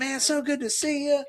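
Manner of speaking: high-pitched, effusive imitation of a greeter; imitated hearty greeting. Acting out stranger's hearty greeting.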